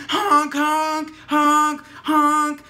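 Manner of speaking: loud, high honking noises; mock horn honks. Mocking the drivers honking at her, loud imitated honks. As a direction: blare it like impatient car horns. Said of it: "honking at me".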